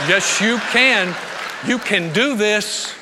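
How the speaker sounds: slow and loud, each word pressed; slow emphatic pep talk; slow, loud encouragement. Retold or imitated. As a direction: urge her on, slowly and firmly. Encouraging daughter firmly.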